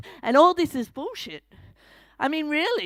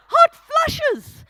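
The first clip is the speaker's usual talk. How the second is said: much higher and louder, overdone outrage; exaggerated mock mouthing; 14 semitones higher, 9 dB louder than usual. Mocking the chemist's whispered hot flushes, hammed-up and loud. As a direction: blow up the mouthed words to mock them. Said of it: "she mouthed them".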